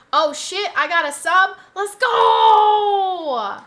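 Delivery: high, loud celebration; thrilled streamer shout; excited cheer. Real, not acted. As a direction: whoop it, stretching the last word into a shout. Celebrating a new subscriber.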